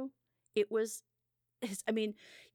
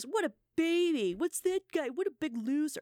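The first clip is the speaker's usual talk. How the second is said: raised, louder jeering at a crybaby; mock heckling; 8 semitones higher, 4 dB louder than usual. Friend heckling the crying man in a diner, mock jeering. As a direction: imitate a heckler, pitched well up. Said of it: "heckling him".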